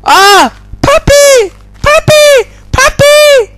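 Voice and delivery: high loud puppy squeals; excited squeal. Real, not acted. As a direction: squeal with delight. Excited at new puppy in game.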